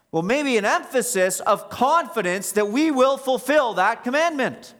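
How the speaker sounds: high-pitched, forceful pulpit delivery; impassioned preaching. Real, not acted. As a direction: voice climbing high and loud, driving the point home.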